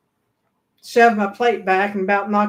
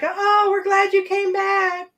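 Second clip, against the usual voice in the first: high, slow mock greeting; mock welcome back; 10 semitones higher, slower than usual. Crew welcomes the runaway back aboard, in high slow mock cheer. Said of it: "he shows back".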